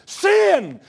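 shouted high preaching; preaching at full force. Real, not acted. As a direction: cry it out from the pulpit.

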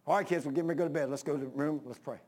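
low, quick, softening parent voice; parent at bedtime. Acted. Parent voiced calling children to pray.

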